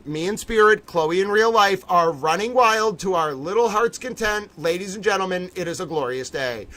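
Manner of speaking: normal talking voice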